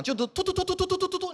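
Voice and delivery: rapid high "tu tu tu" burst like gunfire; machine-gun noise. Retold or imitated. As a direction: spit out a high stuttering machine-gun rattle. Mimics machine-gun strafing the head. (模仿机关枪扫射声)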